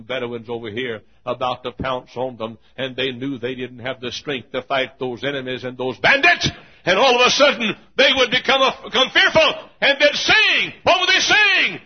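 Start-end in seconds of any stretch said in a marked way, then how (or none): 6.0-11.8 high, loud, ringing preacher's cry; preaching at full force. Acted. Preacher to congregation on the feast trips, full-force preaching. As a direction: lift the crowd with rising fervor.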